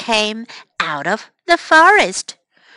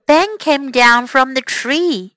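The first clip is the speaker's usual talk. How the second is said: ordinary delivery, nothing marked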